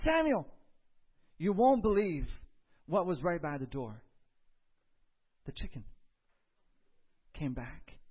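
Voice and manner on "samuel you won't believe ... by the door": high, loud excited call of the name. Retold or imitated. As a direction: burst in with the news. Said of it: "She says".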